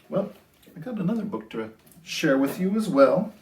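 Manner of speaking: ordinary delivery, nothing marked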